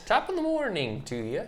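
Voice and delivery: a half-hearted stab at an Irish greeting; mock Irish accent. Co-host tries a weak mock Irish accent. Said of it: "mine's not very good either".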